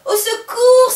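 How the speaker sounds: high, loud frightened shouting; captain panicking in front of the monster; panicked cry. Acted. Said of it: "s'écrie Polo".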